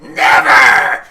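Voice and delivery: loud defiant refusal; defiant shout. Acted. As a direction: spit it back at him. Pinned wrestler refuses.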